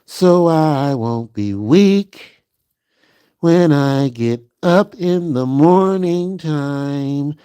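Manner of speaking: mild drowsy bedtime chant; sleepy sing-song. Pretend. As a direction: stretch each phrase out like a lullaby.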